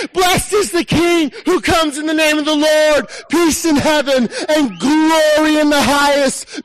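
high, loud proclaiming shout; screaming praise. Acted. Preacher acting the crowd hailing the king, loud shout. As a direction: cry it out to the whole road. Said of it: "you're screaming".

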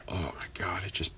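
whispered, fed up; exasperated whisper. Real, not acted. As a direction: breathe it out in a whisper. Exasperated at his friend.

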